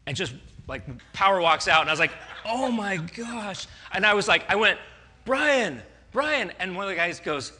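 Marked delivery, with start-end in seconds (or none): 2.4-3.7 slow, high, drawn-out oh my gosh; horrified realization, stretched out; embarrassed disbelief, drawn out; mortified gasp. Retold or imitated. Mortified as Peter appears. 5.2-6.5 loud, high calling of a name; calling Brian after him as he leaves. Retold or imitated.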